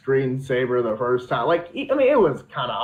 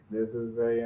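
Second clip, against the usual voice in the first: lightly mimed monotone, softer; 8 dB quieter than usual. Shown as a demonstration. Showing how someone under control talks, monotone. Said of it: "monotone and stuff like that".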